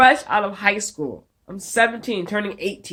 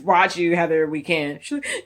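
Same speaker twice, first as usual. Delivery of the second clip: ordinary delivery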